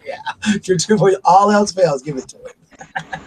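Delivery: giggly, breaking into a whisper at the end; laughing while quoting. Real, not acted. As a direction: laugh through the punchline.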